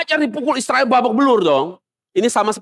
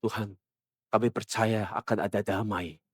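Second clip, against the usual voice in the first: much softer and lower, pious murmured prayer; mock naive prayer; 11 semitones lower, 12 dB quieter than usual. Church members praying for peace during war, soft mock piety. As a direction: pray meekly like a deluded congregation. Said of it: "mereka doanya apa?".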